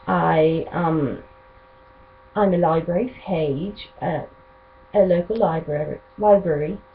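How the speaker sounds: amateur British accent, done for fun. Shown as a demonstration. Vlogger treating viewers to self-introduction and sign-off, British accent. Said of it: "treat of me talking in my accent".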